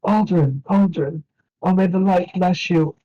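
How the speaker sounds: put-on high, concerned old elven priest; an old priest fussing over the boy. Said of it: "he'll go".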